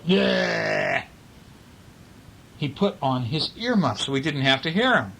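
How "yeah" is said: drawn-out grouchy cry; grumpy old man. Acted. Reader voices old man's reply to birdsong, grumpy. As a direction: grouse it out as one long complaint. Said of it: "He'd go".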